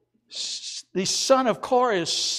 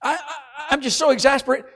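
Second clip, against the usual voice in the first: higher, louder, hammed-up whine of despair; mock despairing psalmist; 8 semitones higher, 5 dB louder, faster than usual. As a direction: play the exasperated complainer with a laugh. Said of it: "He said".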